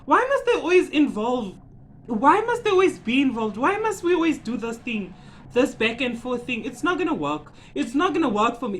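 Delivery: high-pitched, pleading mock complaint; exasperated rant. Fan ranting at parents always getting involved, exasperated. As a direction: hammer out each complaint in rising exasperation.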